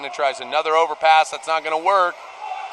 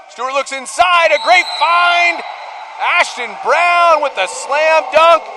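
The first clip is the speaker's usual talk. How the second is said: excited goal call; 9 semitones higher, 4 dB louder than usual. Real, not acted. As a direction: savour the slam dunk as it happens. Live call of a scoring play.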